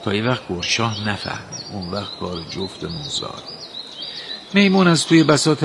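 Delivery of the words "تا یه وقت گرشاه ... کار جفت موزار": softer, conspiratorial whisper into the ear; whispering secretly. A narrator's reading. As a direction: hush it so nobody else hears. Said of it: "توی گوش میمون پچ پچ کرد".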